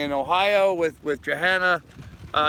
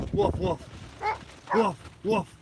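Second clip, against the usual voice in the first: mock dog bark; 3 semitones higher, 4 dB quieter than usual. Egging dog on with barks.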